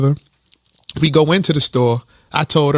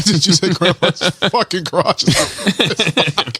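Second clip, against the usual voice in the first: asked through laughter; laughing question; 2 semitones higher than usual. Real, not acted. Friend laughing in disbelief at the story, laughing. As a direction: laugh while asking if he really said it.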